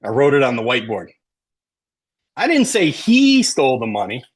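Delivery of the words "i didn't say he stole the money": he stretched out, high and loud; emphatic he, implying it was someone else; stress on HE. Shown as a demonstration. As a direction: hit the word he hard and hold it. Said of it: "if you emphasize certain words".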